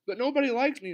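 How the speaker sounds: put-on high, loud childish lament; crying out. A narrator's reading. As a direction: wail it lightly, acted upset. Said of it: "cried Lil Prickles".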